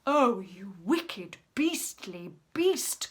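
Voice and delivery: slow, loud elephant indignation; outraged cry. A narrator's reading. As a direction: cry out each insult slowly. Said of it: "cried Trunky".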